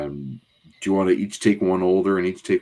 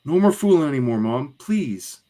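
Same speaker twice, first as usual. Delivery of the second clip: high voice falling to a plea; acted child's pleading; pleading kid; 9 semitones higher than usual. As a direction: beg a parent to stop. Child begs mother, acted.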